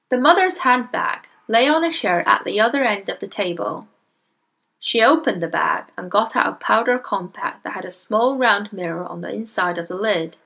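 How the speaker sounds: plain reading voice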